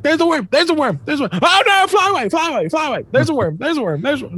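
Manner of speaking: very high, squeaky bird chatter. Acted. Kid mimicking what birds talk about, chattering bird voice. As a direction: chirp it over and over in a tiny high voice. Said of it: "all these fucking birds talk about".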